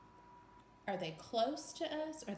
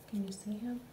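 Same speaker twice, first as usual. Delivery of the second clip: whispered. Real, not acted. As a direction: whisper it like a secret. Reader whispers to children.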